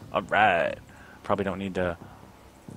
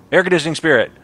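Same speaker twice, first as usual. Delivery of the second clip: excited joke; 9 semitones higher, 10 dB louder, much faster than usual. Real, not acted. Joking exclamation.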